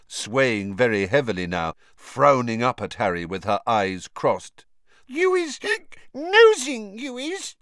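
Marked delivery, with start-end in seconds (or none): 5.1-7.6 high squeaky elf, jabbing with the accusation; hiccuping, louder on nosing; drunk accusing elf. A narrator's reading. Drunk Winky accuses Harry of nosing, squeaky and cross.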